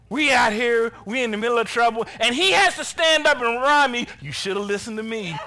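loud, high-pitched comic complaint; mock exasperation. Preacher voicing men annoyed at Paul's reminder, mock exasperation. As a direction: gripe it to the room.

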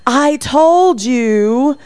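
high, slow, hammed-up scolding yell at the kids; mock angry yell. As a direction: drag out the words like a mom losing it in public. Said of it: "that's when the yelling comes".

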